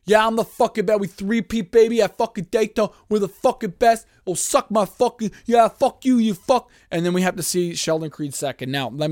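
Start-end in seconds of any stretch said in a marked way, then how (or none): 0.0-6.7 high, loud, cocky bragging voice; mock gloating driver. Mocking the winner bragging after the race, loud and cocky. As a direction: ham up a boastful winner taunting everyone. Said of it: "watch Austin Hill's grumpy ass".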